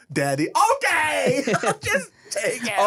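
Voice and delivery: high, hammed-up giving in; mock pushover dad. Mocking a dad who lets daughter do anything, high hammed-up surrender. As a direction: cave in frantically, half laughing.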